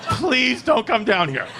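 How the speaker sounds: high, slow begging through laughter; a son begging his father to stay away; panicked pleading. Retold or imitated. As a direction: plead with dad not to come. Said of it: "I was like".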